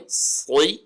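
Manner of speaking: سوئی with a full heavy S; heavy sa sound. Shown as a demonstration. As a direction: say سوئی with a thick emphatic S. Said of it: "اس کو ہم پر کر کے پڑھیں گے".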